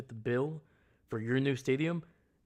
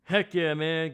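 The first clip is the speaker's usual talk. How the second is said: much higher, louder, bursting with cheer; excited greeting; 11 semitones higher, 9 dB louder than usual. Real, not acted. Streamer greeting Seastrom in chat, loud excited hello.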